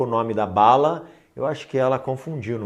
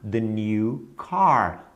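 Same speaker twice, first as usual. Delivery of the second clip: slow model phrase; 2 semitones lower, much slower than usual. Shown as a demonstration. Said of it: "tem que dizer".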